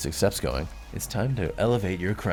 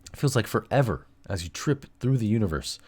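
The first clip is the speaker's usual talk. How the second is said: normal talking voice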